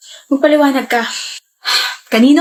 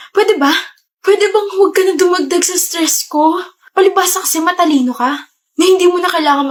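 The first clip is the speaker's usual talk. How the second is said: high, rising exasperated rant; angry outburst; 6 semitones higher than usual. Acted. Stressed student lashes out at her boyfriend, angry rant. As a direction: let the stress boil over. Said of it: "you don't have to shout".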